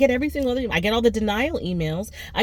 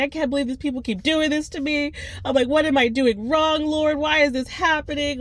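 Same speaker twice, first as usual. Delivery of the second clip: freaking out; 8 semitones higher than usual. Retold or imitated. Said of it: "I was freaking out".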